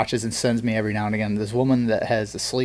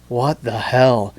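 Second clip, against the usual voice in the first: drawn-out bewildered what the hell; slow mock disbelief; much slower than usual. Shown as a demonstration. Host acting out a viewer scrolling TikTok, slow stunned disbelief. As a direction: gawk at the screen, slowly. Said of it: "Can you show that scroll motion again".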